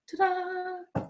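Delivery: high, mock-cheerful ta-da; sarcastic ta-da. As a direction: announce bad news like a bitter magic trick. Announcing the cancer diagnosis.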